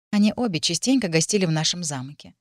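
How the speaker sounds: ordinary delivery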